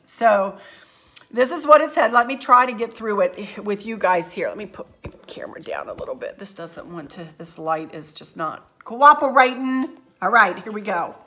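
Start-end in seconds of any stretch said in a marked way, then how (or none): 4.5-8.3 a quick whispered aside; murmured under the breath, fast. Real, not acted. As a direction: whisper it as a side note while fussing with the camera. Aside while adjusting the camera.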